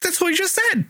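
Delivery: stammer, then a very high baffled protest; high-pitched retold disbelief; incredulous protest. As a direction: object as if nobody is making sense. Retelling own disbelief at dialogue.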